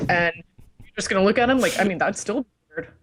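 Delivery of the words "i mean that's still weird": usual speaking voice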